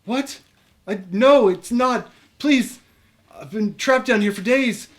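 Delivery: high, loud call through a door; muffled call. Acted. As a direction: call out to be heard. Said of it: "a muffled voice".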